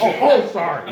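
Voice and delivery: a clueless priest caught out, hammed up; sheepish apology. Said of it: "he's like".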